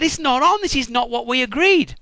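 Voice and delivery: high, loud complaint; indignant protest. Acted. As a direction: insist it's unfair. Jacob protesting the deal.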